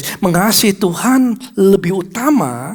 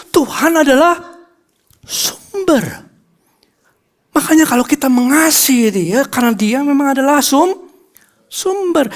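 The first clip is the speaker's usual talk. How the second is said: higher, ringing proclamation; preacher proclaims God is the source to the church; preaching climax; 9 semitones higher than usual. Acted. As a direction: drive the point home.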